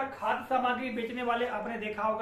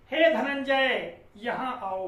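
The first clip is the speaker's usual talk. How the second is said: slow, louder call, Dhananjay stretched long; 5 dB louder, slower than usual. Shown as a demonstration. Teacher calling a friend from afar, slow and loud. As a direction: call across a distance, hold the end of Dhananjay. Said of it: "Dhananjay थोड़ा देर तक बोलते हैं".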